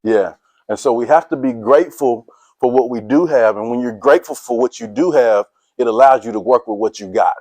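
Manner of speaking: ordinary talk, nothing marked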